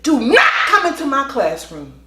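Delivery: loud, firm command; retold shouted warning; stern yell. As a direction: stop her at the door with your voice. Yelling at the mother.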